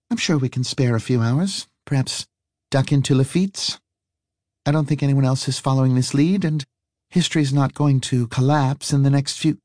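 lightly voiced wry woman; a hint of a teasing woman; smirking woman. A narrator's reading. Harry voiced.

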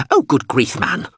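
exasperated exclamation. A narrator's reading. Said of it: "the drone exclaimed".

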